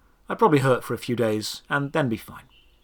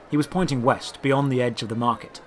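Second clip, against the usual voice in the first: usual speaking voice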